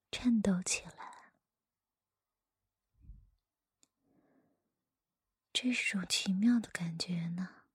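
hushed storyteller whisper; whispered story reading. Acted.

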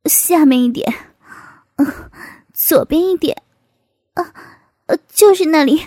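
acted gasps and short cries; calling out between moans. A narrator's reading. Man directs his sister-in-law, calling out between moans. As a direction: break the words up with moans. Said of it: "不时地叫道".

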